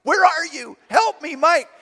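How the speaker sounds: high, loud cry for help; panicked yell. Retold or imitated. Boy chased by angry girl calls his brother, panicked yell. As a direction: shriek for rescue, hammed up. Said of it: "and I'm yelling".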